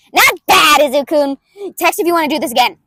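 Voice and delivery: put-on brash inventor girl, throaty and loud; loud brutal May voice. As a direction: bulldoze him with gleeful energy. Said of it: "I do it in the back of the throat, and I do it loud".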